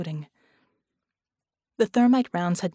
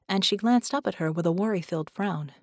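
plain reading voice

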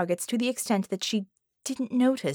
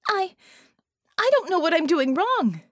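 high, louder despairing plea; frustrated despair; 11 semitones higher, 5 dB louder than usual. A narrator's reading. Harmony plays the failing shooter, despairing. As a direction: push it out, near tears. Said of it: "with frustration and despair in her voice".

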